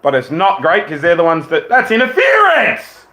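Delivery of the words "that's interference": high, drawn-out outraged yell; indignant shout. Real, not acted. Fan protesting a foul in the game, indignant shout. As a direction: cry foul at the top of your voice. Live reaction to hockey game.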